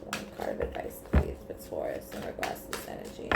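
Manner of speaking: soft whisper, barely voiced; whispered request. Real, not acted. Reader asking the cards for advice, whispered.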